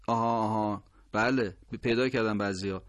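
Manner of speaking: ordinary talk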